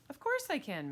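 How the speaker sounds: high, eager assurance; confident girl. Acted. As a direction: assure the grown-up brightly. Girl assures mother she can babysit.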